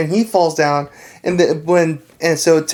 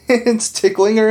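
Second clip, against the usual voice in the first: usual speaking voice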